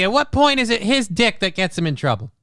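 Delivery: ordinary delivery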